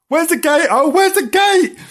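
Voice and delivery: high, frantic kitten voice; hammed-up high panic; panicked panting. A narrator's reading. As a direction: squeal it in a desperate hurry. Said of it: "panted Bernard".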